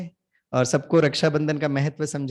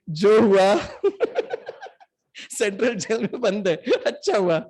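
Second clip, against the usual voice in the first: laughing; 3 semitones higher than usual. Real, not acted. Chanting in jail, crowd laughing.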